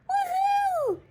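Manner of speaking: high, loud whoop of joy; cheering shout. Acted. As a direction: throw your arms up and cheer. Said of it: "shout the siblings and Akila".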